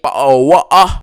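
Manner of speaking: loud, hammed-up British exclamation; mock British accent. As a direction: blurt it out like a startled Londoner, mocking. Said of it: "y'all accent always kills me".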